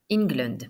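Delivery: "England" starting with an "in" sound, falling at the end; teacher's model of "England", the E said as I; E prononcé comme I. Shown as a demonstration. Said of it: "ça se prononce comme si c'était I N". (« England » dit comme si le E était un I)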